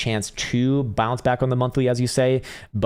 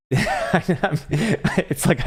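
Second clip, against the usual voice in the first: laughing; 8 semitones higher than usual. Real, not acted. Overwhelmed by a viewer's win.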